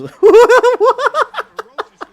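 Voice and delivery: loud, high burst of laughter; loud, high real laughter. Real, not acted. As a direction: crack up hard. Laughing at own story.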